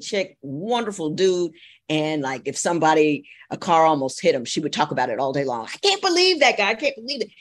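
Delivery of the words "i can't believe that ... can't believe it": high-pitched imitation of angry griping; exasperated high mock rant. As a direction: imitate someone endlessly worked up, pitched way up. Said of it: "She was in the habit of being angry".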